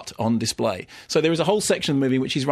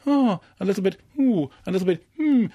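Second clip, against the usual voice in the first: high, cooing little sighs; mock coy movie-star oohs and hmms; Kim Basinger; 7 semitones higher than usual. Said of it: "doing that Kim Basinger look".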